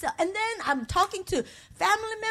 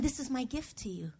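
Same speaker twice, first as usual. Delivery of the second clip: God's gentle voice; 6 semitones lower, 12 dB quieter than usual. Retold or imitated. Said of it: "hearing God saying".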